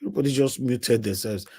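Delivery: ordinary talk, nothing marked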